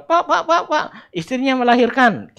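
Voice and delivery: high, loud laughing call; excited messenger yelling; calling out urgently. Retold or imitated. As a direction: shout for the father across the field.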